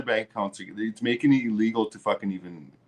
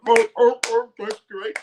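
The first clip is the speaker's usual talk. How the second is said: mock seal barking; 10 semitones higher than usual. Ranting streamer mocks conservatives as barking seals, higher mock seal voice. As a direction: bark it out like a clapping seal, mocking. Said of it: "a bunch of seals in North America going".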